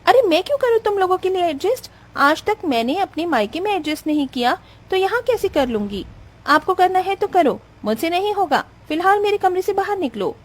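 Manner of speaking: high-pitched indignant tirade; angry, contemptuous refusal. Acted. Acted quarrel, bride throws her out. (ज़लील करती बहू का ऊँचा, गुस्सैल स्वर)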